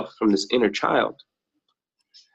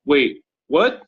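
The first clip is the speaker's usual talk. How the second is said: caught off guard; 6 semitones higher, much slower than usual. Retold or imitated. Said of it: "you should have saw his face".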